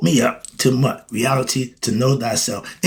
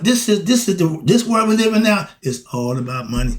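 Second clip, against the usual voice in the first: ordinary delivery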